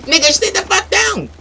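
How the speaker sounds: high, irate command; angry order. Acted. Player ordering rival to sit, angry high-pitched command.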